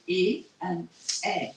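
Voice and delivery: E as closed é, then eh as open è; two contrasted sounds. Shown as a demonstration. Teacher comparing two different sounds, contrasted French vowels. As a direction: set closed E against open eh. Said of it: "two different sounds".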